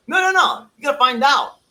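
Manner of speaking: a high, loud "no, no, no" from the coach; coach's loud correction. Retold or imitated. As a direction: cut the player off, firm and loud. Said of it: "tells Peña".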